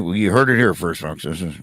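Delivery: low, broadcaster sign-off in a put-on radio host voice; Larry King impression. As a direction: drop the voice into a late-night talk-show host. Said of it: "as Larry King saying".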